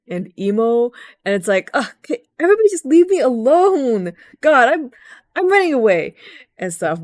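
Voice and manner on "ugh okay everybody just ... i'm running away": put-on high, loud teen huff; mock moody teenager fairy running away from friends; angry emo sylph. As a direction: storm off sulking. Retold moody sylph storming off.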